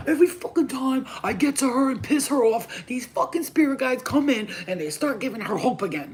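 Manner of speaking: mock-crying entities griping about her hope; whiny spirits complaining. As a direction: moan it like a sore loser. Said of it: "sitting there crying like".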